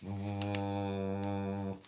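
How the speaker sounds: a quick starstruck "Oh"; lightly voiced gushing fan; mock fan gasp. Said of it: "that was my impression of a fan".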